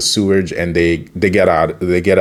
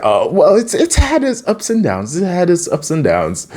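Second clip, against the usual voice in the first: high, wavering comic voice; mock woozy character, pitched up; imitated dazed reply; 10 semitones higher than usual. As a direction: play it up in a high silly voice. Acting dazed Mikey replying.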